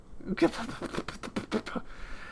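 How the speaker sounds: soft, breathless giggle at own accent; stifled laugh. Real, not acted. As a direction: crack up quietly mid-performance.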